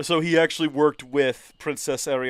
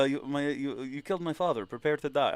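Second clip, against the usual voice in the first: movie avenger quote; 8 dB quieter than usual. Shown as a demonstration. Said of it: "A little bit like".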